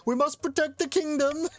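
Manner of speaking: put-on high Scottish accent like Shrek. Priest urging the ruler to protect the realm, Shrek-like Scottish accent.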